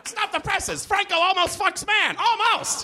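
very high, mock breaking-news cry; headline announcer. Comic voicing a blog's breaking headline. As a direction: shriek it like a tabloid headline.

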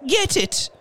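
very high, breathless order; panting. A narrator's reading. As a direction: gasp it out urgently. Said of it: "she panted".

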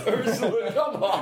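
high, laughing plea to the dog. Real, not acted. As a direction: laugh while begging her to stop.

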